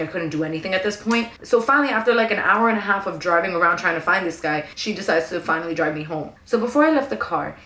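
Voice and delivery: ordinary delivery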